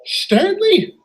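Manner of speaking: high, slow disbelief; astonished. Acted. Engine spotting Stanley after hearing his whistle, astonished. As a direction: gape in disbelief at an old friend. Engine stunned to see old friend.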